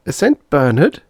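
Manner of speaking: put-on a little boy let down; dismayed boy. Bob hearing what he is, high voice.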